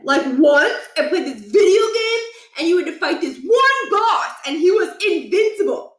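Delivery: put-on high, rushing boyish voice full of wonder; a kid breathlessly telling about a video game; excited kid Stuart. Stuart gushing to friends about a game boss, high and excited.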